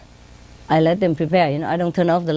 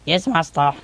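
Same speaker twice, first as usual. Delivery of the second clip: man's voice. Retold or imitated. Parrot mimics male assistant answering owner, man's voice. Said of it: "say it in a man's voice".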